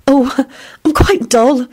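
high, coy modesty; coy reply. A narrator's reading. Beatrice answers Lupin about herself, coyly. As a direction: play it down coyly. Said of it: "she replied coyly".

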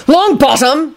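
high-pitched mild shout. A narrator's reading. Kingsley catches Neville at the door, high mild shout. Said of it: "he shouted".

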